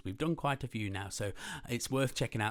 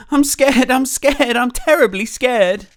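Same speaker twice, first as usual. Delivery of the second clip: high, loud fearful wail; repeated frightened cry; terribly scared; 12 semitones higher, 19 dB louder, slower than usual. Acted. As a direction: panic like a timid cook.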